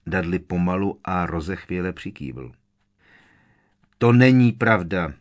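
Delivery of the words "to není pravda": loud, firm denial; emphatic protest. A narrator's reading. Boy denying he harmed his cousin, emphatic protest. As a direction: push back firmly against the accusation. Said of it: "ohradil se důrazně Harry".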